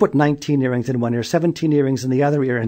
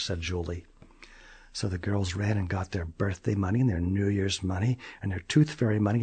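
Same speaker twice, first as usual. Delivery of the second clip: ordinary delivery, nothing marked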